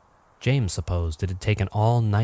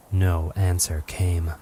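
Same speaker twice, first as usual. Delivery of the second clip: ordinary delivery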